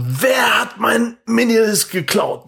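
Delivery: high, drawn-out shouted demand; retold angry shout. Robbed teenager confronting a café full of men, shouted. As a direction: bellow it slowly, acting out the scene. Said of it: "Das schrei so voll rein".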